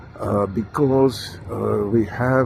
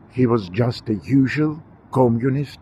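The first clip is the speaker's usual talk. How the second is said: normal talking voice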